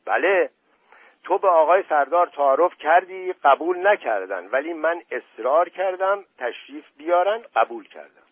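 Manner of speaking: put-on childish anger. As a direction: whine it back like a spoiled kid.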